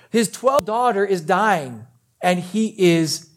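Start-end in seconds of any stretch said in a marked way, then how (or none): none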